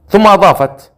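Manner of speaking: plain reading voice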